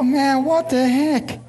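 put-on drawn-out, high, disbelieving kid; jealous brother, slow high disbelief; slow mock dismay. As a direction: stretch it out, slow, in a put-out kid's voice. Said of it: "I go".

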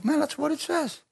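high, soft amazed discovery; high, hushed wonder. Retold or imitated. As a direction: marvel at finding it.

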